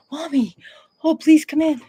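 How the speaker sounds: high, pleading puppy voice; dog's voice. Pretend. The dog Tuck begging mommy to come in, high pleading. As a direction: beg like a little dog wanting in. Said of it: "He's like".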